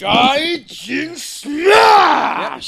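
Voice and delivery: big drawn-out shout; shouted intro. Acted. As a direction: yell the show name. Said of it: "you spit a little bit there".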